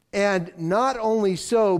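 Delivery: ordinary talk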